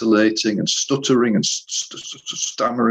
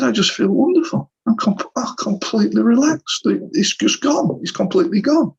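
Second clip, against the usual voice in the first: much higher, slowing on calm, amazed relief; blissful relief; 12 semitones higher than usual. Retold or imitated. Client telling therapist he feels wonderful, high amazed relief. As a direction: imitate a client overjoyed at feeling calm.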